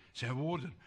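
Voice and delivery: low, hushed aside; soft echo. Shown as a demonstration. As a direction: drop the voice to a murmur.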